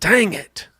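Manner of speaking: high-pitched burst of real frustration; getting emotional. Real, not acted. Trader reacting as the price went up, emotional and frustrated. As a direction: exclaim it high, openly exasperated. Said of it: "why am I getting emotional?".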